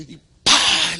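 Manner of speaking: mock push sound. Mimicking a preacher pushing people.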